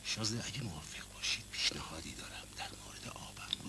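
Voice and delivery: soft unvoiced conspiratorial whisper; hushed private exchange; whispered aside. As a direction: lean in and whisper. Private aside between two men.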